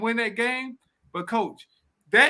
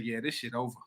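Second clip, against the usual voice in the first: low, much softer retold aside; quiet resigned aside; 9 semitones lower, 13 dB quieter, faster than usual. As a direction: drop the voice right down, as if conceding to companions. Retold aside to his companions.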